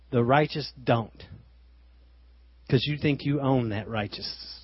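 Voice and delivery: ordinary talk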